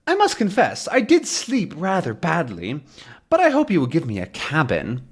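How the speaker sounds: high put-on voice of an oblivious professor; oblivious deaf professor. Deaf Calculus answering Haddock, politely oblivious. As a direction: confess politely, oblivious to the anger.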